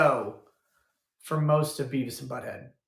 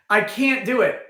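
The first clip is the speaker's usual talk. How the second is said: ordinary talk, nothing marked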